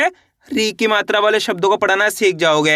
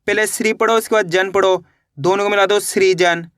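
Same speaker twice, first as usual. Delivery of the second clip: usual speaking voice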